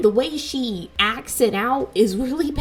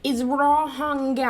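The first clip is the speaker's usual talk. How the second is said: slow, emphatic scolding; drawn-out, exasperated acted reproach; mock lecture; 4 semitones higher, slower than usual. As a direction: spell it out slowly as if they won't listen. Acts out lecturing a guilt-tripper.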